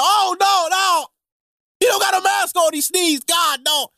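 hammed-up terrified bystander; mock panic. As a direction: yelp like a cough is a gunshot. Panicked person reacting to sneeze.

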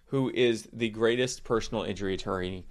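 usual speaking voice

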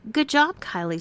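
high, sugary fake praise; mock sincerity. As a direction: praise her with a sneer underneath. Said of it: "said Amanda with mock sincerity".

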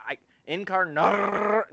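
high, slow Encarnar- breaking at the R; failing to trill the R in Encarnar-; rolled r. Shown as a demonstration. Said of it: "I can't do it at the R".

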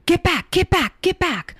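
imitating a panicked warning, high and loud; high, urgent mock shout; retold yell. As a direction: yell it out like an acted crowd warning. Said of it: "and he yells".

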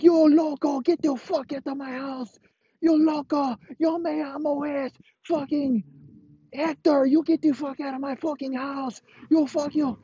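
very high-pitched, ranting homeowner. Acted. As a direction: shriek high like a furious man chasing out an intruder.

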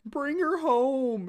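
high and very slow, drawn out; hammed-up pleading mother; imitating the mom; mock mom voice. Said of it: "quoting the mom Barb".

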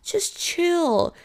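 mock-annoyed put-on voice; mocking dismissal. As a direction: mimic someone brushing off panic. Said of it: "this stupid person on your side being like".

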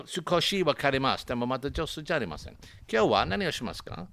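Japanese phrases said with Japanese sounds; spoken Japanese. Shown as a demonstration. Asked by his friend, he shows off his Japanese. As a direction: rattle off some pidgin Japanese. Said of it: "speak to me in Japanese".

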